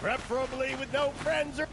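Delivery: high, sustained hawker's cry; calling out. Acted. Hawker seeking able-bodied adventurers, calling out.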